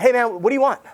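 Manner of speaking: hurried and brusque; loud stressed brush-off; impatient demand. Retold or imitated. Stressed hedge fund guys.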